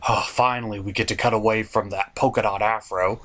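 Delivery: ordinary talk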